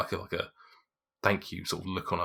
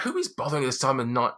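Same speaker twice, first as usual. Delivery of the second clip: indignant wife; 6 semitones higher, faster than usual. Acted. Wife repeats indignant demand.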